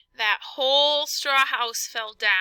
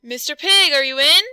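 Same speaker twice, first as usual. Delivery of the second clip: loud, higher call to the door; wolf calling at the stick house, loud and higher; 4 semitones higher, 10 dB louder than usual. Acted. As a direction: shout it up at the house. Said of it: "I called".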